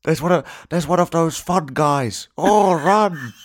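high, loud put-on zombie voice; hammed-up shouted alarm in a silly accent; zombie spectator accent. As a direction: call out like a panicked zombie watching TV. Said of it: "What is that accent?".

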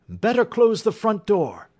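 loud, high-pitched order; urgent cry. Acted. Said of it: "cried Holmes".

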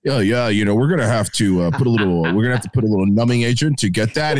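low, measured doctor voice; imitated doctor. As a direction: mimic an articulate doctor, pitched well down. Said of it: "this guy's like".

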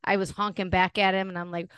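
ordinary delivery

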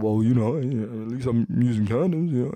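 mumbled mock-dopey self-defence; imitated sheepish excuse. Man excusing himself to his annoyed date, mock sheepish. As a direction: replay your own feeble excuse like a fool. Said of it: "now my stupid ass".

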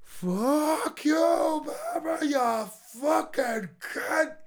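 high, drawn-out mock scream; imitated yelling. Storyteller imitating his friend yelling at Barbara, high mock yell. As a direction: stretch it out like someone screaming from the driveway. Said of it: "Just starts yelling".

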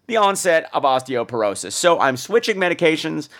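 ordinary talk, nothing marked

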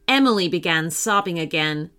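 ordinary delivery, nothing marked

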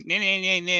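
mock broad Scottish denial; thick Scottish mock. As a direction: ham up a broad Scottish accent. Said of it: "is thicker Scottish than it actually is".

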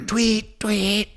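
mock police whistle; imitated whistle. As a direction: mimic the cop's whistle again. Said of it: "this whistling".

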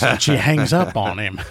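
normal talking voice

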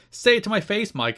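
retold in-your-face taunt; confrontational challenge. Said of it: "It's like".

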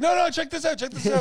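hammed-up eager friend butting in; mock excited interruption. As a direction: push in like a kid showing off a toy. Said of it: "you'll be like".

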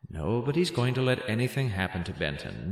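ordinary talk

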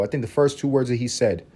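ordinary talk

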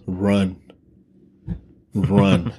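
ordinary talk, nothing marked

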